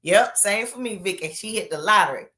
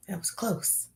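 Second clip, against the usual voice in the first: breathy relieved whisper; 9 dB quieter than usual. Real, not acted. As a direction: whisper it under your breath. Relieved aside after near mishap.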